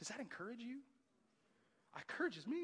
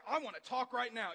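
demanding; 8 semitones higher, 7 dB louder than usual. Retold or imitated. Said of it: "You're like".

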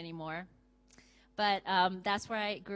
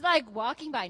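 ordinary talk

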